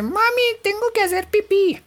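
put-on very high-pitched small child voice; high kiddie voice; little kid. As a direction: whine it up high like a little boy needing mom. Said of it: "cuando el niño chiquito dice".

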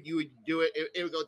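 ordinary talk

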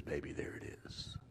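soft unvoiced tag line; whispered aside. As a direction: lean in and whisper. Whispered punchline.

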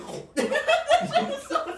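very high, loud squealing cackle; shrieking laugh. Real, not acted. Cracking up while telling friends about the flying teeth, piercing shrieky laugh. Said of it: "how you make that noise right now?".

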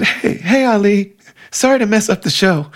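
injured friend calls out brightly to visitors, high mock shout; imitating Julie. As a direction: pitch it way up like a friend shouting from behind the curtain. Injured friend calling out cheerfully.

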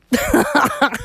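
high burst of real laughter; sudden high giggle; laughing. Real, not acted. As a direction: crack up at your own slip. Laughs at own made-up word.

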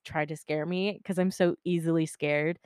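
ordinary delivery, nothing marked

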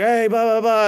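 high mock shout in babble; imitated yelling. Storyteller as Steve yelling in Portuguese, high mock yell. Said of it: "like, yelling in Portuguese".

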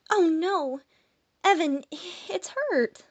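put-on worried girl. Malia telling Evan the pixie is hurt, worried and high. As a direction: sound alarmed for the little creature. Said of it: "The female responded to my pain".

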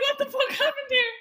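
high laughing disbelief. Retold or imitated. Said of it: "I was like".

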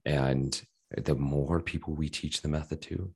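usual speaking voice

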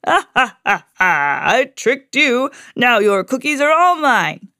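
laughing, gloating villain cry. A narrator's reading. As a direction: crow over the stolen cookies. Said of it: "cried Big Bad Pete".

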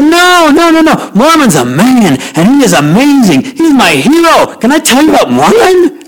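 high, eager and bubbling over; eager excitement. Retold or imitated. Eager passenger talks up his hero, high and excited.

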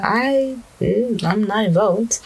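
high put-on voice disowning all blame; slow, high-pitched mock denial; Shaitan. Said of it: "Shaitan's gonna be like".

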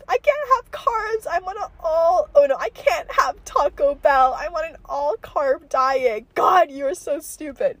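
high, slow, drawn-out diva whine; Mean Girls. Retold or imitated. Parody of a dieting movie girl, hammed-up disdain. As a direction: play an exaggerated snooty popular girl. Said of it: "like, Mean Girls or whatever".

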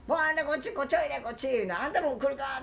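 high beckoning Osaka auntie. Retold or imitated. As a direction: call everyone over like an auntie. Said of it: "とか言って、もういろんな人呼んで".

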